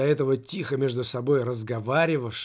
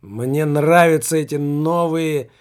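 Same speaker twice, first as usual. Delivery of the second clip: loud, drawn-out proclamation; enthusiastic exclamation; 8 dB louder than usual. A narrator's reading. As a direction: exclaim it slowly and forcefully. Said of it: "воскликнул Немаркетинг".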